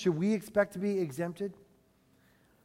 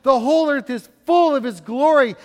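loud, ringing call of holy; seraphs calling out; 6 semitones higher, 10 dB louder than usual. Acted. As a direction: proclaim it like angels crying to each other. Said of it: "they called to one another".

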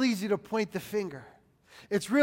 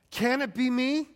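higher, rising plea; earnest acted question, pitched up; humble questioning; 4 semitones higher than usual. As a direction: lift the question upward, searching yourself. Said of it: "engage in humility and then think".

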